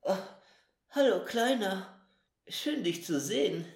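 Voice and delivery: hoarse, just-woken voice. Acted. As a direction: rasp it out hoarsely after a long sleep. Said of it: "sagte er mit heiserer Stimme".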